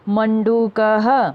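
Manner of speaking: मंडूकः very slowly, closing on "ha"; model pronunciation. Shown as a demonstration. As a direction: stretch each syllable of मंडूकः, visarga as "ha". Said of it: "उनको भी उच्चारण करना है".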